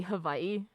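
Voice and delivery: "Hawaii" said ha-VAI-ee with a v; "Hawaii" as huh-VY-ee, over-careful; over-correct pronunciation. Retold or imitated. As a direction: put a v in "Hawaii", like a pedant.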